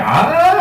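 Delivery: high, swooping "ahh"; imitated "ah". Comic mimicking a man walking towards him, high "ahh". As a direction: send the ahh way up in pitch. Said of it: "He's walking towards me like".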